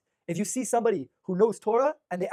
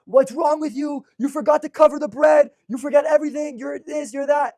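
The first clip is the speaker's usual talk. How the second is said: high, loud furious yelling at the wife; a hammed-up angry scream at the Shabbat table; retold angry screaming; 8 semitones higher, 10 dB louder, slower than usual. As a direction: blast her like an enraged husband, much higher and louder. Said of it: "he starts screaming at his wife".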